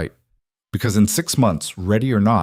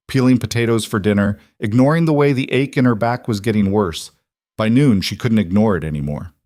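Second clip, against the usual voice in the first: ordinary delivery, nothing marked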